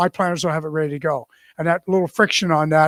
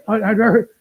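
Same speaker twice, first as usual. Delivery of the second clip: normal talking voice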